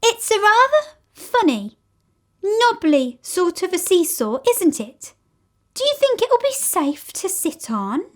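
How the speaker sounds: put-on high, questioning girl's voice; older girl doubting the odd seesaw; doubtful older girl. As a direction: wonder aloud if it's safe. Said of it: "Then a girl ... said".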